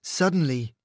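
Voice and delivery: usual speaking voice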